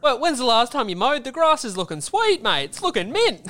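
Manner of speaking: high, loud matey small talk to a father; hearty dad-chat voice. Retold or imitated. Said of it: "change your whole cadence of talking".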